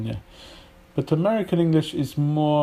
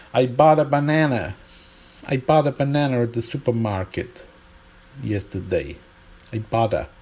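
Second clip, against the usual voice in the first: American "bought" with ah and flat-a "banana"; American accent. Shown as a demonstration. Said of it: "imagine this sentence".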